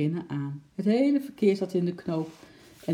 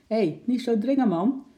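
loud, higher protest; calling out; 7 semitones higher, 5 dB louder than usual. A narrator's reading. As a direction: shout at someone pushing through the crowd. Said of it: "riep een slagersknecht".

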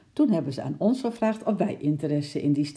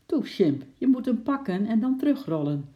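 put-on a child's voice, higher, with a sigh; sighing little girl; 7 semitones higher than usual. A narrator's reading. Lotje coaxing Sjimp to fetch the ball, sighing. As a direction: coax the chimp, a bit weary. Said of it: "zei Lotje met een zucht".

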